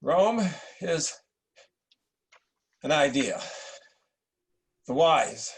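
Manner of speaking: slow, weighty command; a hint of a stern dying father; insistent dying emperor. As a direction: press the wise must rule on him. Emperor insists on his will.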